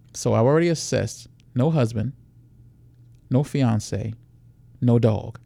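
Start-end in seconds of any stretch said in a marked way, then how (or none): none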